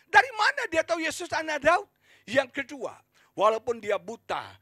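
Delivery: high, loud, urgent sermon; full-force preaching. Real, not acted. Preacher on how Bartimius knew of Jesus, full-force preaching. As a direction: fire questions at the congregation with force.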